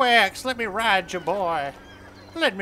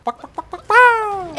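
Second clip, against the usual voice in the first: high clucks ending in a loud squawk; chicken clucking; 13 semitones higher, 13 dB louder than usual. Pretend. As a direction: cluck like a hen, then burst out.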